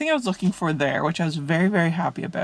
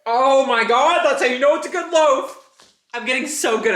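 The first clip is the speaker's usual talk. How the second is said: excited; 6 semitones higher, 3 dB louder than usual. Real, not acted. Admiring her loaf.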